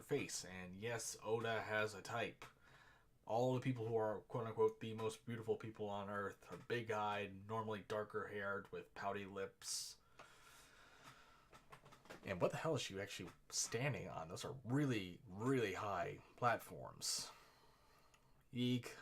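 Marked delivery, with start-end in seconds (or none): 3.3-17.3 excited rant. Real, not acted. Excited commentary on the design. 18.5-18.9 loud squeak. Real, not acted. Mock fright squeal.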